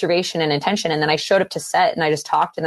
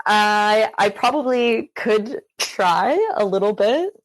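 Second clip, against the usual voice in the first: higher, slow try at a British accent; 5 semitones higher, slower than usual. Shown as a demonstration. Guest trying out their best accent for the interviewer, British accent. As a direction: roll tentatively into British speech, drawn out. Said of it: "tries to use their best British accent".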